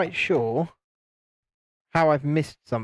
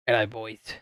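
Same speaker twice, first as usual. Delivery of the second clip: lower, put-on mate's greeting; Vaughn voice; 6 semitones lower, 5 dB quieter than usual. Shown as a demonstration. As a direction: nail the friend's hello. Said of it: "I think I've got my Vaughn voice down".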